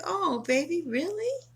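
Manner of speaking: high, soft and sugary; a tender, high coo of concern; sweet baby talk. Retold or imitated. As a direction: coo it sweetly to your man. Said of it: "I would be like".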